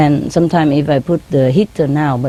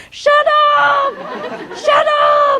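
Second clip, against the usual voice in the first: "Shut up" squawked very high, drawn out; mock parrot screech on "Shut up"; long, noisy scolding; much slower than usual. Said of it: "if it take too long and too noisy".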